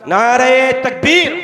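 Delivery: slow, loud drawn-out call; preacher leading a crowd chant, drawn out and loud; slogan call. Real, not acted. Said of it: "मैं नारा लगाऊंगा".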